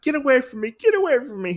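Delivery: very high, panicky coward imitation; mock scaredy cat. Oda fends off Lady Asano, high mock-frightened squeal. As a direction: squeal it like a play-acted coward. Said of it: "he's a scaredy cat".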